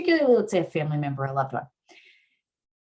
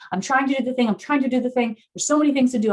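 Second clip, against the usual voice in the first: higher-pitched, pressured overwhelm; activated, frazzled; 6 semitones higher, faster than usual. Acted. Overloaded worker in sympathetic activation, frazzled. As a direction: repeat it like someone frantically juggling tasks. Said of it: "I'm activated and sympathetic".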